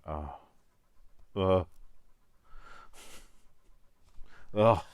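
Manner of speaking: loud, self-mocking "oh" groans; exasperated groan. Watching their own video back, loud cringing groans. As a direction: groan loudly at yourself, spaced out.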